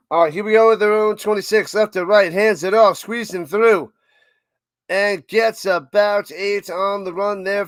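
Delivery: fast, loud sportscaster call; play-by-play call. Acted. Streamer calling the game for chat, loud and fast. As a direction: call the run like a live broadcaster.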